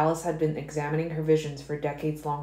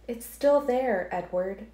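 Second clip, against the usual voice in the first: higher, slow female voice; Alice firmly telling Edward the vision remains; Alice, steady; 7 semitones higher, slower than usual. A narrator's reading. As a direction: lift into Alice and say it slowly.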